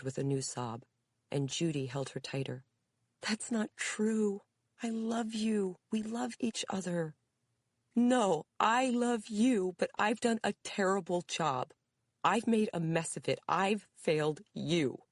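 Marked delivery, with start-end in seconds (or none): 3.2-7.2 high, slow, tender reassurance; tender daughter. A narrator's reading. Daughter comforts crying mother. 7.9-15.0 tearful mother. A narrator's reading. Mother sobs her regret.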